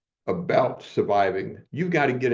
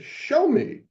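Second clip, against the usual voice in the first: very high, insistent Show me; teacher's challenge. Retold or imitated. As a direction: throw down the dare one more time. Teacher repeats challenge third time.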